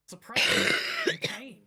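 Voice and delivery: sudden pained yell; shouting. Acted. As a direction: cry out in surprise and pain. GM voicing shouts in scene.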